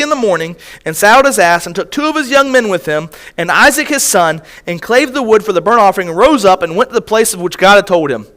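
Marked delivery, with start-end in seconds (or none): none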